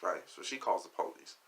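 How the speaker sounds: normal talking voice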